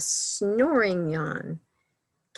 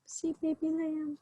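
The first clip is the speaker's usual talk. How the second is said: high soft voice; 8 semitones higher, 8 dB quieter than usual. Acted. High soft baby-lamb aside.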